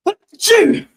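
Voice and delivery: sudden high, loud sneeze; a real, explosive sneeze. Real, not acted. Sneezes mid-stream.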